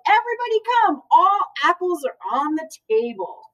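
high call to the whole yard. A narrator's reading. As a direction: call everyone in from outside. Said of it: "Later she called".

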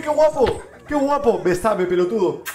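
ordinary talk, nothing marked

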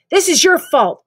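furious yell, loud and high; angry shout. Acted. Narrator yelling at his friend in fury. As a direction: shout the blame at him.

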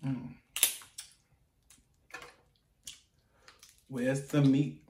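muffled by food; chewing while talking. Real, not acted. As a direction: mouth partly full. Seen 0: Bit crab just before; closed working mouth while picking shells.

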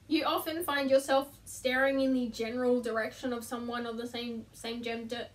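falters and repeats SAME near the end; tongue-tied stumble. Real, not acted. Vlogger fumbles the fifth list item, tongue-tied stumble. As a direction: trip over "same gender", losing volume. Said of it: "I can't speak today!".

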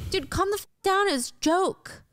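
high, irritated defense; acted sibling exasperation; exasperated brush-off. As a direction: tell your sister to chill. Brother brushes off sister's complaint.

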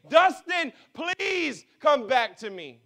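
high, loud, slow pleading call; God calling a lost son home; crying out. Acted. God crying out to Dustin, loud slow plea. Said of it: "He's crying out to us and saying".

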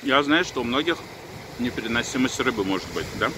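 normal talking voice

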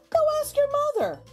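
ordinary delivery

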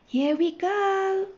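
high, drawn-out train's cry; departure call. A narrator's reading. Train sets off with children aboard, long high call.

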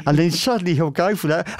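ordinary talk

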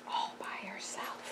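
breathy whisper, hushed and sad. Real, not acted. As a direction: breathe it out with sympathy. Hushed aside about lone girl.

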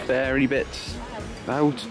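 normal talking voice

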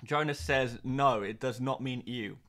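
ordinary talk, nothing marked

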